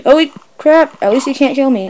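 normal talking voice

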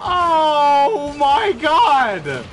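high, loud string of panicked cries; frantic yells. Real, not acted. Gamer yelling through a chaotic play, frantic. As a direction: shout each oh as it goes wrong. Wild moment in game.

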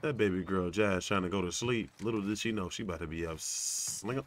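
usual speaking voice

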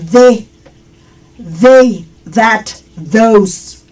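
"de, they, that, those" with TH like Spanish D; each of "they", "that", "those" isolated, soft voiced TH; soft TH demo. Shown as a demonstration. Teacher shows TH in "they, that, those", clear soft-D sound. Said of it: "T H es".

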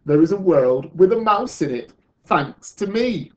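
boastful storybook exclamation; exclaiming. A narrator's reading. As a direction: declare it triumphantly, slowing at the end. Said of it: "he exclaimed".

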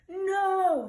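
loud, high, drawn-out shout. A narrator's reading. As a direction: shout it in alarm to stop someone. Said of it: "shouted Bartholomew".

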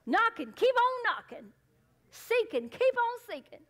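high, loud punches on "Knock" and "keep on knocking", "Seek" and "keep on seeking"; emphasized. Shown as a demonstration. Preacher urging persistent prayer, "Knock" and "Seek" loudly emphasized. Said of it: "I'll emphasize".

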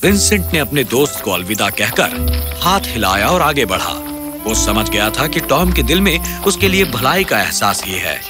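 usual speaking voice

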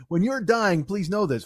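usual speaking voice

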